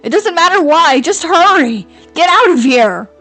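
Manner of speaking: frantic shouted command; desperate shout. Acted. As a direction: scream at her to get out. Urgent warning to flee.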